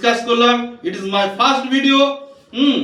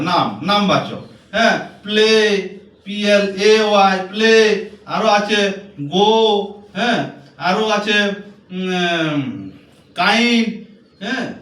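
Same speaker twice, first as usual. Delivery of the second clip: normal talking voice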